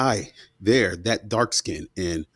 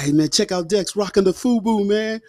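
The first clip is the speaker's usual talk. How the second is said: put-on higher, impressed kid voice; mock admiring kids; 11 semitones higher than usual. Schoolkids admiring his fubu jacket, higher, mock impressed. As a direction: play the kids gushing over his outfit. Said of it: "Everybody like".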